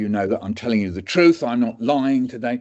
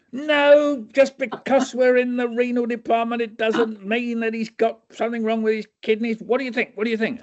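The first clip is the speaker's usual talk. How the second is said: much higher, exasperated upper-class lecturer; John Cleese; 13 semitones higher than usual. Retold or imitated. Doctor corrects students, high and exasperated. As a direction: scold them like a fussy schoolmaster. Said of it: "It turned into John, John Cleese now".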